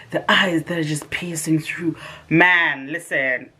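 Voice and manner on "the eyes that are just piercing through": whispered awe. Retold or imitated. Describing his eyes.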